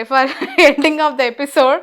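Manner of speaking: ordinary talk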